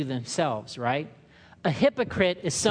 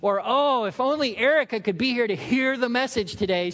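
higher, louder pious sigh; mock self-righteous wish; 5 semitones higher, 3 dB louder than usual. Churchgoer wishing others heard the sermon. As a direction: sound smugly wishful. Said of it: "we do the thing where".

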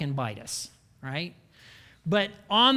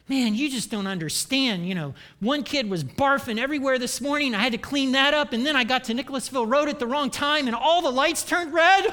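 rising, louder whiny excuse-making; defensive excuses; 6 semitones higher, 5 dB louder than usual. Retold or imitated. Late coworker excuses herself, rising into a whine. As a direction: talk yourself out of blame. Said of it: "it's like".

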